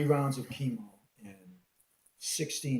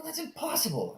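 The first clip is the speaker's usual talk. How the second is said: high-pitched, incredulous denial; incredulous, pitch jumping up; disbelieving protest; 4 dB louder than usual. Retold or imitated. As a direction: protest it as if it cannot be true. Acting out disbelief at cancer diagnosis.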